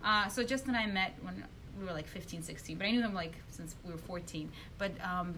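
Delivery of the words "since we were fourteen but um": usual speaking voice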